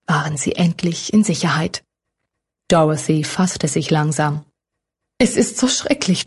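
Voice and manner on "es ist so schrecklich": sobbing. A narrator's reading. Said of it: "schluchzte sie".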